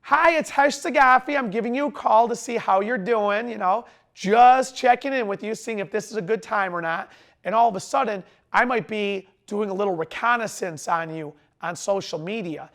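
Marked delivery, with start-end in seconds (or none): 0.0-7.1 louder, upbeat phone greeting; peppy check-in with a customer; happy sales voice. Shown as a demonstration. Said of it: "this is my happy voice".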